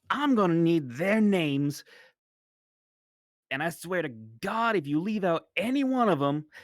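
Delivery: mercenary leader threatening a traitor, loud and angry; snapping threat. Acted. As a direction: snap it as a threat, loud and hard. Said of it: "Cudgel snaps at that and goes forward".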